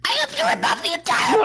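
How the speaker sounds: high fast angry yell; frustrated shout. Real, not acted.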